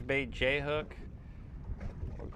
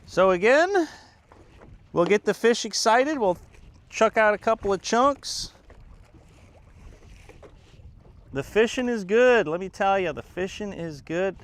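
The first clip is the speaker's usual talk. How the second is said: excited; 5 semitones higher, 5 dB louder than usual. Real, not acted. Excited fishing patter on stream.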